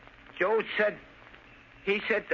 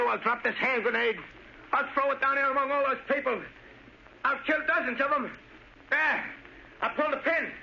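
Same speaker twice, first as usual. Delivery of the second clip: rising, higher hysterical threats; climbing into frantic panic; cornered sniper screaming he'll throw it; panicked threat; 9 semitones higher than usual. Acted.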